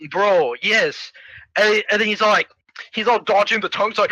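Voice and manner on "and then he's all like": ordinary delivery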